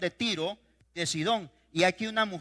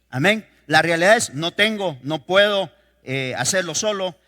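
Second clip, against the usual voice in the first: preaching at full force; 9 dB louder than usual. Acted. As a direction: pound each phrase home to the congregation. Preaching emphatically on faith. (predicando con toda la fuerza, en voz alta)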